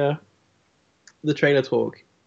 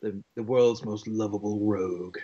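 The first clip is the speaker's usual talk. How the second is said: trailer voice; 3 semitones lower, 5 dB quieter than usual. Shown as a demonstration. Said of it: "the nineties trailer guy voice".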